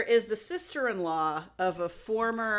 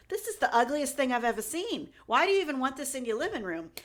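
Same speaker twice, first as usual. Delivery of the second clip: higher-pitched mock Boston accent; 4 semitones higher than usual. Sister-in-law judging the painting she helped hang, mock Boston accent. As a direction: imitate an opinionated woman from Boston. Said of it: "she sounds like she's from Boston".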